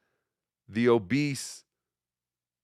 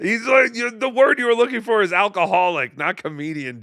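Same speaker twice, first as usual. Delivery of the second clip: mock correction; 7 semitones higher, 4 dB louder than usual. Host mockingly corrects Joey.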